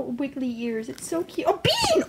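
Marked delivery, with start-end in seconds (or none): 1.5-2.0 high, startled cry of dismay; startled exclamation. Real, not acted. Owner catching Bean misbehaving, startled exclamation. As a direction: cry out suddenly at the rat.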